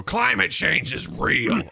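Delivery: mock ranting declaration, drawn out; Alex Jones. Podcast host opening with a mock rant. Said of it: "Alex Jones-esque".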